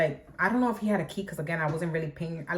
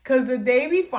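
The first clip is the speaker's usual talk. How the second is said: laughing; 5 semitones higher, 6 dB louder than usual. Real, not acted. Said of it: "I'm laughing, but it's not funny".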